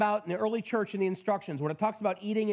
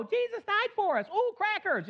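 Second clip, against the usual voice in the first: high-pitched imitation of a small child; little kid voice; 13 semitones higher than usual. Preacher mimics little kids at communion, high child voice. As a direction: put on a simple, high kid voice.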